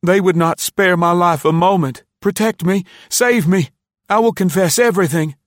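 high, loud, frantic pleas for mercy; begging and pleading. A narrator's reading. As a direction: beg for your life in a high, crying voice. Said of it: "begged and pleaded".

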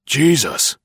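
high, loud shocked gasp of a word; jolted by someone appearing in the dark; startled exclamation. A narrator's reading. Startled neighbor collides in dark.

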